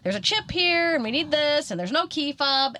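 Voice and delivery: high, pointed list of complaints; firing off demands, pitch up; pushy demands. Retold or imitated. Telling the salesman what's wrong, high and insistent.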